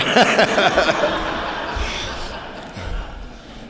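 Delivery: airy falling laugh; a real laugh at his own joke; breathy laugh. Real, not acted.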